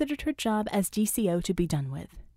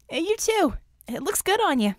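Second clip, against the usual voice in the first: higher, louder call back; 10 semitones higher, 3 dB louder than usual. A narrator's reading. Scope to Bella across the lot, higher louder call. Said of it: "Scope called back".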